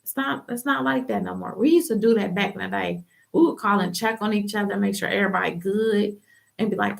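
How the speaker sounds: ordinary talk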